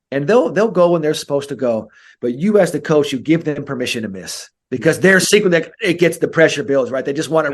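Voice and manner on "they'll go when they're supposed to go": normal talking voice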